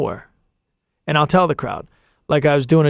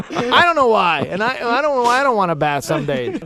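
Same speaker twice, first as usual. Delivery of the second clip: mock yell; 9 semitones higher, 3 dB louder than usual. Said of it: "I'm yelling".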